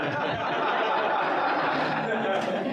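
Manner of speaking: sudden high, loud embarrassed laugh; burst of laughter. Real, not acted. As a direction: laugh it off mid-sentence. Embarrassed laugh mid-introduction.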